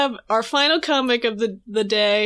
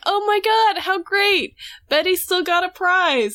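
higher-pitched gushing congratulations; mock delight; 7 semitones higher than usual. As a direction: gush happily, as if thrilled for a friend. Voicing Midge and Nancy's delight.

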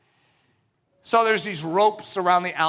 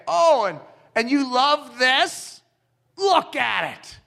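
slow, higher, sneering taunt; mocking taunt; 4 semitones higher, slower than usual. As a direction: rub it in heaven's face. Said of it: "literally snubbing its nose at heaven".

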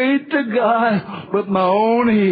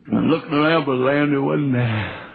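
normal talking voice

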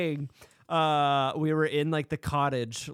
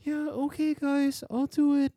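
higher, easygoing fake-confident reply; mock casual agreement; 10 semitones higher than usual. Agreeing to talk to the ghost alone, breezy and high. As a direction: play it cool and breezy, pitched up. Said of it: "I was like".

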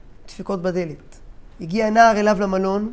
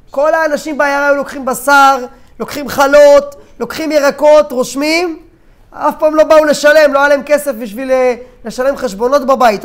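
loud, raised voice, insistent; impassioned outburst; 4 semitones higher, 7 dB louder than usual. Acted. Merchants revealing to the rabbi who paid everyone, impassioned. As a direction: press the point forcefully.

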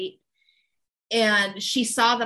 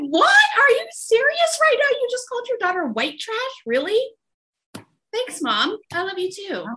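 high, incredulous retort, louder at first; indignant disbelief; 11 semitones higher, 5 dB louder than usual. Retold or imitated. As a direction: play it as the hurt daughter who can't believe what she heard. Daughter retorts to mom's insult.

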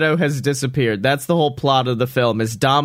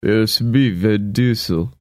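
low, slow action-star rumble; Vin Diesel; 4 semitones lower than usual. Acted. Introducing himself as the film's main character, Vin Diesel impression. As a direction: put on a low tough-guy voice, a bit Stallone. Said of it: "I kinda sound like, um, Sylvester Stallone".

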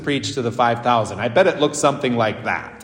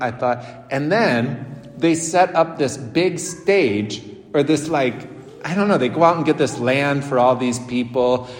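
ordinary talk

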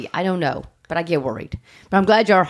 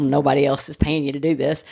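ordinary talk

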